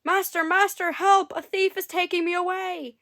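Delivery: high, loud panicked cry for help; frightened harp calling out; harp screaming. A narrator's reading. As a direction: shriek for your master in terror. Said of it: "began to scream".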